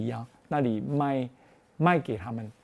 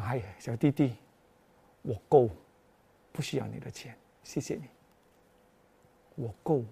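gentle refusal; 7 semitones lower, 10 dB quieter than usual. Retold or imitated. Old woman gently refuses money.

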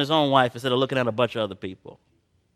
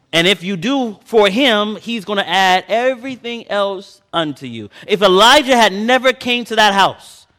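raised, slow preaching voice, building; fervent preaching; 8 semitones higher, 6 dB louder than usual. Acted. Preacher urging his congregation, in a raised preaching voice.

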